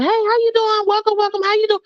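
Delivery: high, sing-song hello to everyone; cheery greeting. Retold or imitated. Greeting coworkers walking through the job, high cheery welcome. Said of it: "me walking around".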